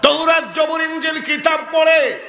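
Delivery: high, ringing proclamation; emphatic proclamation. Retold or imitated. Priest reveals her family's identity to Amina, proclaiming high. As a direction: declare it high, ending on a called name.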